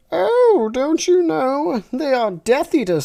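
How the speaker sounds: very high, slow squeaky Teletubby voice. Acted. Reader voicing a Teletubby, very high and squeaky. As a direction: pitch way up like a toddler toy character.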